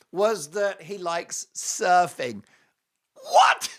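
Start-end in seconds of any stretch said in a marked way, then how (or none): 3.1-3.7 a high, loud "What?" of disbelief; incredulous shout. Real, not acted. Reacting to Harry's surfing answer with a shocked shout. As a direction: bark out total disbelief.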